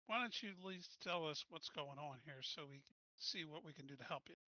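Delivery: ordinary talk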